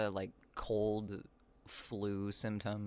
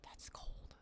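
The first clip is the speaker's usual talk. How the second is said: whisper; 13 dB quieter than usual. Real, not acted.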